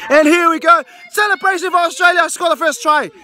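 high, excited rugby commentary; live play-by-play. Pretend. Commentator follows the ball to the first try, excited play-by-play. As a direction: call the match live, pitched high with excitement.

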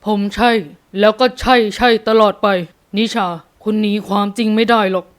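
forceful male character voice; insistent man. A narrator's reading. Father contradicting the mother, insistent male voice. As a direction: hammer home the claim.

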